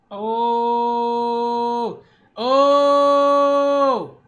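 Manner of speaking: loud, high, drawn-out oh; real hyped oh; hyped shout. Real, not acted. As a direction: yell it out in pure excitement. Hyped reaction to fight move.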